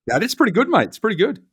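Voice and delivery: a playful hint of the TV psychic praising a good read; John Edwards. Guest teases the host's accent guessing in a high mock psychic voice. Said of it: "John Edwards".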